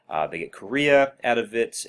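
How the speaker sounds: normal talking voice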